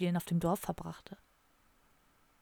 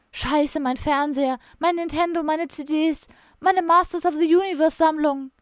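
higher and louder wail over lost things; despairing outcry; 7 semitones higher, 6 dB louder than usual. Acted. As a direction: cry out each lost treasure in dismay. Bogi discovers his burnt things. (verzweifelt höher und lauter aufschreien)

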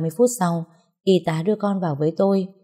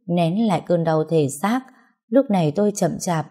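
ordinary delivery

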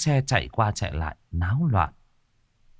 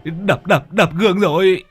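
high, louder, rushed stammer; stammering panic; 7 semitones higher, 3 dB louder than usual. Acted. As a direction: blurt it out in a panic. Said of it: "Long Đầu Đất lắp bắp". (giọng lắp bắp hoảng sợ)

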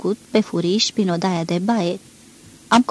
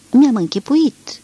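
curt reply; 5 semitones higher, 5 dB louder, slower than usual. A narrator's reading. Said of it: "am răspuns sec".

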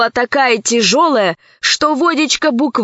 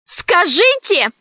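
demanding; 8 semitones higher, slower than usual. Acted. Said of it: "громче всех требовала Лара".

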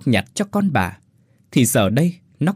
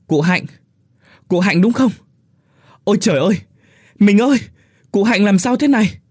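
much higher and louder panicked call; alarmed calling; 10 semitones higher, 6 dB louder than usual. Acted. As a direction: shout across the river in alarm. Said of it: "gọi giật giọng". (gọi giật giọng hoảng hốt)

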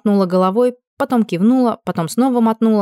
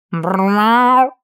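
rolling cat snort; 3 dB louder than usual. Acted. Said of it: "фыркнул он".

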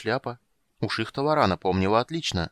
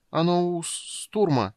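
stammering fear; 9 semitones higher, slower than usual. A narrator's reading. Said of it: "запинаясь от страха".

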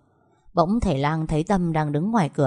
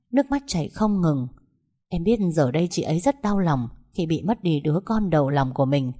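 ordinary delivery, nothing marked

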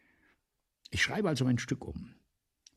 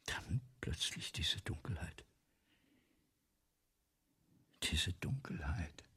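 soft, slow whisper, trailing into dread; fearful whisper; 6 semitones lower, 10 dB quieter than usual. Acted. Writer to Brissot, confessing his failing eyes, in a fearful whisper. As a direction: breathe the words as darkness falls. Writer describes sudden darkness, afraid.

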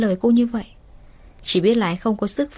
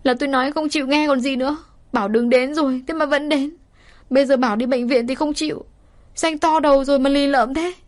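snapping; 5 semitones higher than usual. Acted. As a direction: yell at him for being stubborn. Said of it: "Cô nghe vậy gắt lên". (gắt lên, cao và to)

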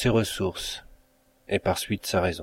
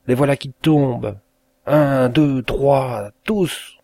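higher, rising anguished count; despairing cry; 5 semitones higher, 4 dB louder, slower than usual. A narrator's reading. Mother watches her children fall into the flames. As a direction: break into a scream on the last word.

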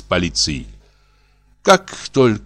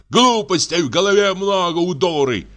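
angry; 7 semitones higher than usual. A narrator's reading. Husband rants at wife.

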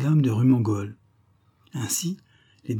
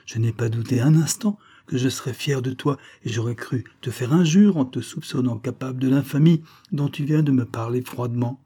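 plain reading voice

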